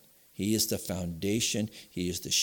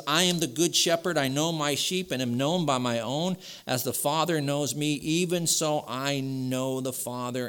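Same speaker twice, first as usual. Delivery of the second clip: higher, louder preaching of the verse; fervent scripture reading; 7 semitones higher, 4 dB louder than usual. Acted. Pastor proclaiming Jesus' words to his church, fervent. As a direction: proclaim it to the congregation.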